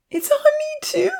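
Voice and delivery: put-on very high girlish wail. A narrator's reading. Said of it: "Lily wailed".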